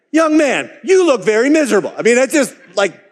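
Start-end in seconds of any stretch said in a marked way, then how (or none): 0.1-1.9 much higher, louder blunt call; startling call-out. Retold or imitated. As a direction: point at him and say it bluntly. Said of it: "he startled him by saying".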